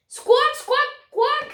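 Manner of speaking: high, loud squawking parrot. Acted. Red and green parrot squawking at Beaky, high and loud. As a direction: screech it like an indignant bird. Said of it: "the red and green parrot said".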